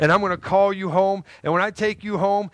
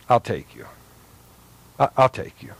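low and tender; Jesus' gentle voice; 8 semitones lower than usual. Pretend. Jesus tenderly welcoming a wretched person, low voice. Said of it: "and yet Jesus goes".

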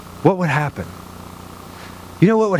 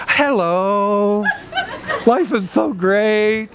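drawn-out, sing-song phony greeting; hammed-up church-door cheer; fake cheerfulness; 3 semitones higher, much slower than usual. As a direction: gush with pretend bliss.

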